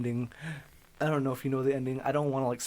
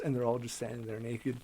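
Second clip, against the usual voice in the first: ordinary talk, nothing marked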